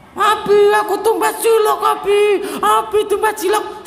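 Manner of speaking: put-on high, loud child begging for cilok; whiny child. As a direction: shout like a kid nagging dad for a snack. Child begging father for snack.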